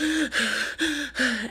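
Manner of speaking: sharp gasp, pitch up; retelling a gasp after choking on mucus; gasp for air. Retold or imitated. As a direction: gasp like you can finally breathe again. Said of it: "and I was just like".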